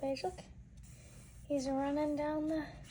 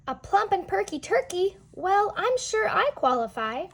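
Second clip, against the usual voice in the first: higher, louder jaunty turkey voice; proud hearty turkey cry; 9 semitones higher, 9 dB louder than usual. Acted. Pete the turkey answers the poster, proud and hearty. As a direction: crow it out like a cocky turkey. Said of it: "he gave out a hearty cry".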